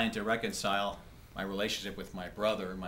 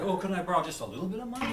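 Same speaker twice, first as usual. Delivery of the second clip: pleading little brother; 7 semitones higher, much faster than usual. Acted. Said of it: "And then I'm down here like".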